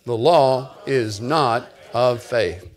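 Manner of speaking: deliberate, drawn-out recital; prompted recitation. Shown as a demonstration. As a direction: space out each word for the congregation to join. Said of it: "Say it".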